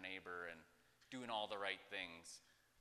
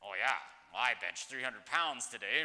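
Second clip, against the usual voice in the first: louder, puffed-up bragging; mock boast; 9 dB louder than usual. Calvin bragging to the congregation about a lift he didn't do alone, mock boast. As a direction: brag loudly like you did it all alone. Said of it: "how ironic that would be".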